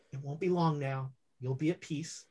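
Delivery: hushed and gentle, close to her ear; tender whisper-soft comfort. Acted. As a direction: soothe her softly as she slips away. Ghost comforting his dying wife.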